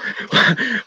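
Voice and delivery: a sudden high-pitched cackle; amused high laugh. Real, not acted. Laughing at own joke.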